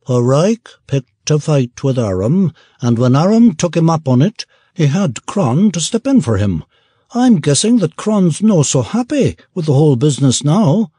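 dwarf Merkelmore reporting what he saw, shifted voice; gruff dwarf. Acted. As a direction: tell it dryly as the dwarf.

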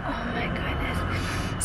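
breathy hushed whisper; barely voiced awe. Real, not acted. Soft reaction over the new puppy.

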